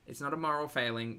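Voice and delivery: ordinary delivery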